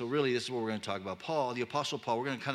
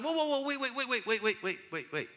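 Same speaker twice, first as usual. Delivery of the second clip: Paul as a dismissive counselor, lightly voiced; flippant counselor. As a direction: cut them off and wave it away. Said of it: "And Paul's like".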